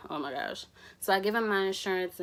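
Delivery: ordinary talk, nothing marked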